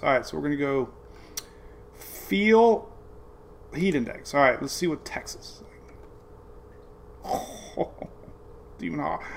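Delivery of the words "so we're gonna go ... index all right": normal talking voice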